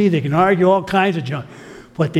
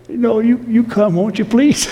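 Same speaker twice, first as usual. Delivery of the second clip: pleading elder; 6 semitones higher than usual. Retold or imitated.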